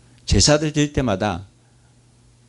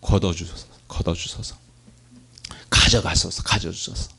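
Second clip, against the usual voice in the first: muttered mock prayer; 4 semitones lower than usual. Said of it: "뭐라고 기도했어요".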